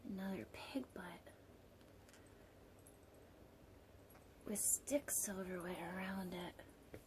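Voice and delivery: hushed whispered aside. Real, not acted. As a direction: whisper it like a secret. Whispered aside about picture.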